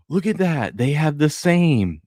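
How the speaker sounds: high, slowing voice of lightly played wonder; imitated child. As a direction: marvel like a kid who just noticed. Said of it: "Although [name] did go".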